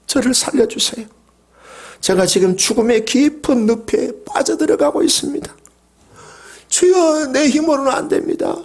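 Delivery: high, urgent pleading prayer; a sinking man begging God; desperate plea. Acted. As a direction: beg God to pull you out. Acted desperate prayer for rescue.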